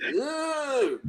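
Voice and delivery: high, drawn-out groan of disgust; disgusted ugh. Retold or imitated. Retelling the bad bridal party, a high disgusted ugh. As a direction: let out a mock grossed-out ugh. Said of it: "I was like".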